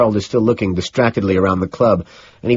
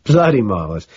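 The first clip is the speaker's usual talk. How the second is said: higher, hearty amused approval; chuckling approval; 5 semitones higher, 4 dB louder than usual. A narrator's reading. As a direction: relish the joke, punching up the praise. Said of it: "He keeps chuckling".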